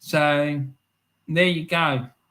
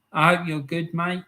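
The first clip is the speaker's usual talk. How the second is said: ordinary talk, nothing marked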